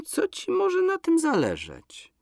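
high, slow feigned innocence; innocent. A narrator's reading. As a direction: play innocent, drawn out and high. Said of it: "z niewinną minką powiedziała Donka".

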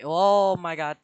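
normal talking voice

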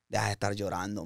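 hushed, low retelling; retold urging. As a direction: quietly imitate a friend's consoling order. Retold friend telling him stop crying.

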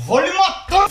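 battle cry. Acted. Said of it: "O menino vem pra cima".

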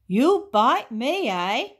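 loud, high, amused taunt; slow, lilting mockery; chuckling. A narrator's reading. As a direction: toss it back with a touch of chuckle. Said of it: "chuckled Boo-hoo".

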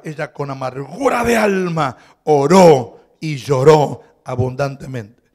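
fervent reading. Acted. Reading Hannah's bitter prayer aloud.

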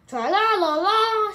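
high, very loud superhero battle cry; loud shout. A narrator's reading. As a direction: belt it out high and wavering. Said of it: "shouted Captain Underpants loudly".

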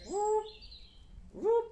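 high squeaky robot whoop; a playful robot sound effect for the kids; robot noise. Shown as a demonstration. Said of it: "You make a robot noise here".

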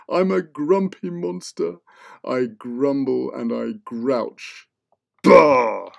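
slow grumbling monster voice ending in a loud bah; grumpy monster. Acted. Grumpy monster grumbling at the kids. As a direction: grouch it out like a cranky beast.

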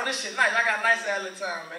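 ordinary talk

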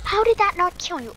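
loud, high disbelief; exasperated high outburst; incredulous protest. Real, not acted. Protests a failed kill.

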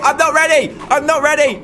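losing nerve as enemies swarm; panicked. Real, not acted. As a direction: cry it out in sudden panic. Onslaught arrives, panicking.

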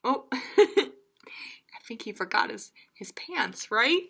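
ordinary delivery, nothing marked

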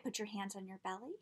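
guided breathing. Shown as a demonstration. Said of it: "Let's try it".

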